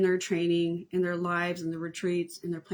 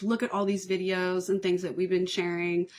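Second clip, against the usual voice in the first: usual speaking voice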